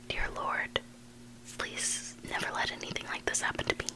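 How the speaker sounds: plain reading voice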